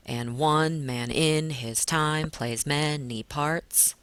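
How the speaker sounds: slow beat on ONE, IN, TIME, MA of many, PARTS; exaggerated meter. Shown as a demonstration. Teacher exaggerating the second line, slow alternating weak and strong syllables. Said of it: "exaggerating it a little bit".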